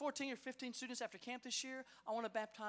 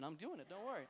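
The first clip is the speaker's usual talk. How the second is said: normal talking voice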